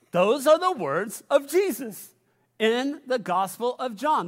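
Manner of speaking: usual speaking voice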